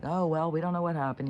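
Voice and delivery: high, mock-innocent excuse; feigned innocence. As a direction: imitate cops playing dumb. Mocking cops' feigned innocence.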